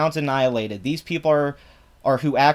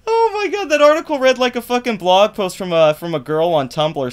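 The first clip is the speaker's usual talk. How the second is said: much higher, louder cry of disbelief; incredulous outburst; 6 semitones higher, 5 dB louder than usual. Real, not acted. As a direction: shriek in shocked disbelief. Incredulous outburst about the article.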